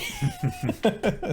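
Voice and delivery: laughing high; high-pitched burst of laughter. Real, not acted. Laughs at own impression.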